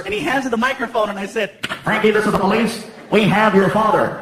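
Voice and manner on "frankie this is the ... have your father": police loudspeaker. Acted. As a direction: announce it like a cop on a speaker. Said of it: "You wanna use the microphone?".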